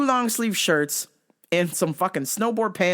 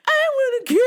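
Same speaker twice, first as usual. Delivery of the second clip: mocked ex; 5 dB louder, much faster than usual. Ex begging to keep cat, mocked whine. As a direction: squeal it out, mocking the ex. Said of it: "the X Factor at the time was like".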